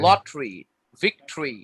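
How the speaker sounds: "লটারি", "ভিক্টরি" as two-syllable lot-tree, vik-tree; demonstrated pronunciation. Shown as a demonstration. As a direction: drop the middle vowel in "লটারি, ভিক্টরি". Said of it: "এখন বলবো লটারি". ("লটারি, ভিক্টরি", লট্রি আর ভিক্ট্রি)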